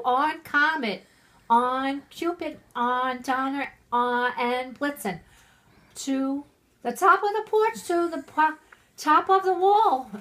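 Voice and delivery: loud high calling out; shouting reindeer names. Acted. Santa calling his reindeer by name, loud and high. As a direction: call the reindeer like a driver. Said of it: "he whistled and shouted".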